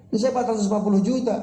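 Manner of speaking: high, fast, aggrieved outburst; indignant tailor. Acted. Tailor protesting the price to the buyer, high and fast. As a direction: rattle off the price in high-pitched disbelief.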